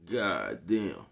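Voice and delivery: usual speaking voice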